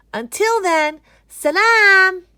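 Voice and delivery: high, loud, drawn-out salam; loud cheery goodbye. Acted. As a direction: call out a bright farewell. Kids say goodbye.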